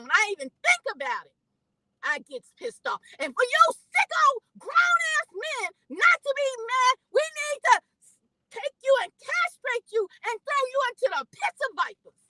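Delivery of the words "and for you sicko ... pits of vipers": much higher, loud furious tirade; real rage, pitched high; furious shout. Real, not acted. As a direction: spit out the punishment in rage. Furious tirade about punishing abusers.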